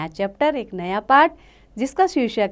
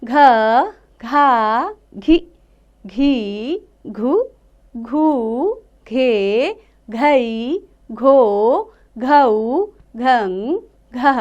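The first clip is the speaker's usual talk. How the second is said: put-on teacher leading children in reciting ख, खा, खी; syllable drill; much slower than usual. Shown as a demonstration. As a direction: clear chanting of each syllable for children to repeat. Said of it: "आप भी मेरे साथ पढ़ते रहिए".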